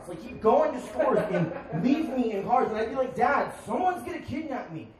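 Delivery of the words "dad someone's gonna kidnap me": put-on high childlike whine; whiny child. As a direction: whine up to dad like a scared kid. Child whining to dad.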